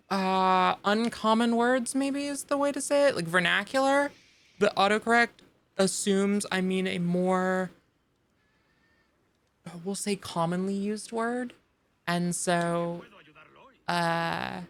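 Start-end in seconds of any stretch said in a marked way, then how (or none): none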